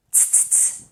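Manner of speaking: unvoiced repeated tss; voiceless hiss. Shown as a demonstration.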